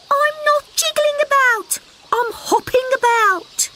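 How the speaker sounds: high indignant little frog; protesting little frog. Acted. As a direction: insist you're only hopping.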